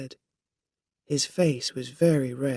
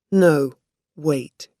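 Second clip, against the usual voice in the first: loud; 8 dB louder than usual. A narrator's reading. Pulling drunk man away.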